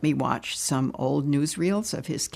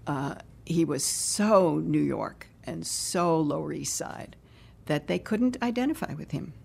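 normal talking voice

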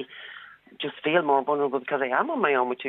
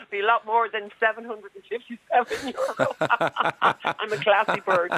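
higher voice breaking into laughter; laughing joke; 7 semitones higher than usual. Real, not acted. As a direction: joke through your own laughter. Joking about herself, laughing.

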